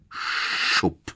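unvoiced rushing "shup"; breathy sound effect of something shooting in; whispered whoosh. A narrator's reading. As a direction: hiss out a sudden swoosh. Imitated whoosh of arriving owl.

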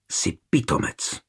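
hushed, voiceless hiss; hissed insult. A narrator's reading. Girl turns the sword on the knight, hissing. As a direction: hiss it under your breath. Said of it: "a sykla".

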